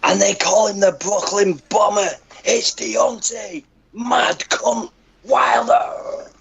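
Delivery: high, very slow announcer bellow; boxing ring announcer. Shown as a demonstration. Ring announcer hyping Deontay Wilder to friends on a call, slow and high. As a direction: draw out the fighter's name like a title bout. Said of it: "Do a boxing call?".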